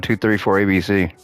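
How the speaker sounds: ordinary talk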